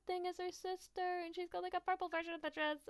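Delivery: very high gushing squeal; excited squeal. Real, not acted. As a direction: squeal with delight over her. Gushing over a girl's artwork.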